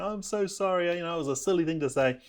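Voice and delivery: lightly imitated weepy wife; a touch of mock tears; tearful apology. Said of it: "she's in tears and she said".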